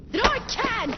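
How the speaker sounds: high, loud defiant cry; shouting back, stubborn and indignant. Acted. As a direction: throw it back at him in defiance. Defiant outburst at partner.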